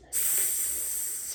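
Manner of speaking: soft unvoiced hiss; rats hissing. A narrator's reading. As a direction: hiss through teeth like rats. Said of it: "hissed the other rats".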